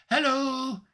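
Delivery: high, tinkling little greeting. Acted. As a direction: sing out high like a tiny sprite. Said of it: "her voice tinkles".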